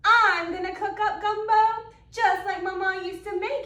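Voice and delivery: loud, hot-tempered outburst; angry cry. Acted. Gator fed up with the teasing, crying out loud. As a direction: boil over like the gumbo. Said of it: "he cries".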